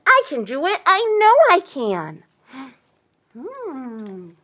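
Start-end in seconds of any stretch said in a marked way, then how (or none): 0.1-2.2 high, loud eager insistence; eager fawn. A narrator's reading. Fawn insists it can go, high and eager. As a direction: insist keenly as a young deer. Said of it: "volunteered the fawn".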